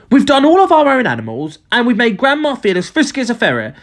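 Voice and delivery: put-on high-pitched child's reasoning; protesting boy. A narrator's reading. George reasoning with Dad about the animals, protesting. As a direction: object like a puzzled boy.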